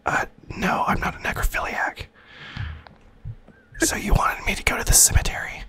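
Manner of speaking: hushed game whisper; leaning in to whisper. Real, not acted. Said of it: "get my whisper voice on".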